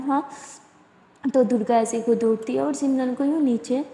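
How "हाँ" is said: ordinary delivery, nothing marked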